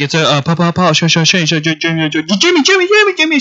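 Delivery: high, stuttering guesswork in rapid repeats; fake TV medium. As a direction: stammer syllables until a name lands. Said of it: "he's like".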